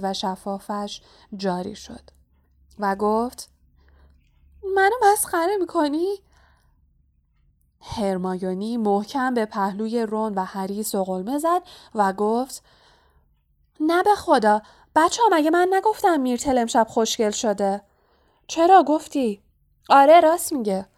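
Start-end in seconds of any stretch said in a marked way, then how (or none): 4.8-6.2 high-pitched wounded question; tearful. A narrator's reading. 13.8-20.9 loud, high-pitched hasty reassurance; insistent protest. A narrator's reading. Nudges friends to back up lie.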